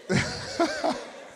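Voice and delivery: high, airy burst of laughter; real amused laugh. Real, not acted. Preacher tickled by the oddly floating microphone, laughing.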